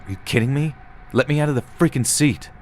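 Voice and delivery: angry outburst. Retold or imitated. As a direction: lose your temper at the man blocking you in. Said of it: "as I have a bit of a temper and say".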